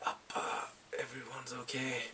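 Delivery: soft, hushed words to father; hushed tender words. Retold or imitated. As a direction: barely voice it, half whispered. Son talks to dead father.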